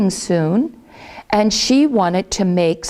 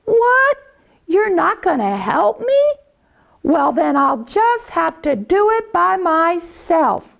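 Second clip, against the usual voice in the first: surprised hen; 9 semitones higher than usual. Acted. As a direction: jump up high in disbelief then settle. Hen reacts to refusal.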